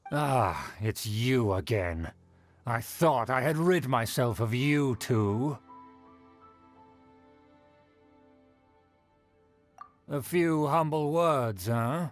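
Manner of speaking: self-important, disdainful greeting; haughty. Acted. Aristocratic uncle greeting two unwelcome visitors, haughty. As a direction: speak as if far above your visitors. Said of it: "this guy thinks so highly of himself".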